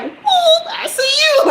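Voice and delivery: very high, squeaky playful whoop; cheeky cartoon voice. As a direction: pop up high, as if personifying something. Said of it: "his little member wouldn't be like".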